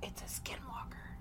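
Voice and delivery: unvoiced whisper. Real, not acted. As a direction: breathe it out in dread. Listener whispers dread.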